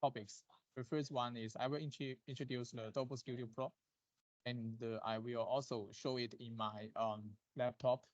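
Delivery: faint, far from the mic; barely audible voice; too quiet. Real, not acted. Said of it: "your voice is very low".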